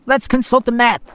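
Herman's suggestion. Acted. Herman suggests consulting the map, lightly voiced. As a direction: offer the plan calmly as Herman. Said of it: "Herman suggested".

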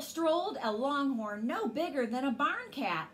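plain reading voice

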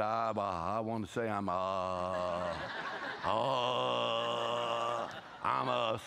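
low, halting, hesitant mock apology; reluctant apology. Husband forcing out an apology to his wife, low and halting. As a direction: drag it out like it hurts.

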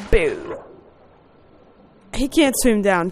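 normal talking voice

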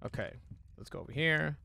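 ordinary talk, nothing marked

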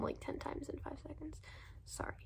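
whispered embarrassed aside; whispering. Real, not acted. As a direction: drop to a whisper. Whispered self-conscious aside.

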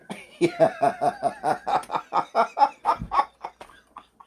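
long loud burst of laughter. Real, not acted. Narrator cracks up loudly at his own story. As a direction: laugh hard and high at the story.

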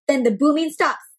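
plain reading voice

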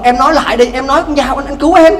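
rising to a high loud plea; bargaining husband. Acted. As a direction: press her to say it as a stubborn husband. Husband bargains, wife drowning.

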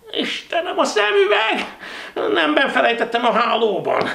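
very high, loud cry of dismay; dismayed exclamation. Acted. Narrator fumbling for the note without glasses, dismayed cry. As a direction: wail at the forgotten thing. Realizes the glasses are left behind.

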